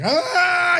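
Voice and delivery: long high-pitched mock scream; drawn-out "aah" in a high voice, not full volume; retold scream. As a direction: imitate his terrified scream, high and sustained. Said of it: "I start screaming".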